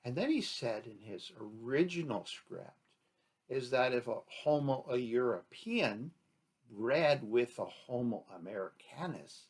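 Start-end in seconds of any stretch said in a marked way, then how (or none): none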